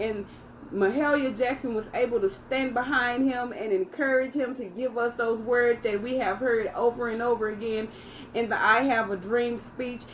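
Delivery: usual speaking voice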